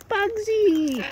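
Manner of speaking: high, loud delighted call of the name; excited greeting. Real, not acted. Feeding birds, greeting Bugsy on arrival, high excited call. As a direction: call out to the bird, thrilled it's back. Greets a returning favourite bird.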